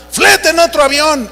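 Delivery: high-pitched mock order; bossy demand. Famous preacher to airline clerk, imitated bossy demand. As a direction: order the staff about, indignant.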